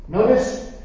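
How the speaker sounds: ordinary delivery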